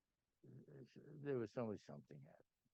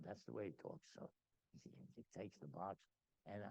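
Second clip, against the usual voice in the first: ordinary delivery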